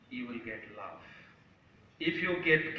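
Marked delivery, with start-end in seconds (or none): 0.1-1.0 low, very soft close; solemn consequence. Acted. Son states nature's lesson on love, solemn and hushed. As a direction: hush the answering half of the lesson.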